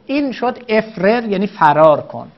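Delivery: normal talking voice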